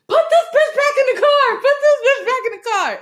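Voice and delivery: high, loud frantic shout; frantic order, shouted high; panicked yell. Retold or imitated. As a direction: yell it like the friend is dying in front of you. Yelling retold in panic.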